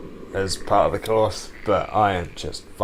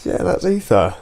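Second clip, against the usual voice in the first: high, gleeful announcement; excited high exclaim; 11 semitones higher, 6 dB louder than usual. Real, not acted. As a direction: crow it with delight. Excited reaction to music.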